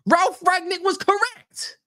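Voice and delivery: high, slow, incredulous; mock disbelief. Shown as a demonstration. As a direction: squeak it in mock shock. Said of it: "repeated in mock voice".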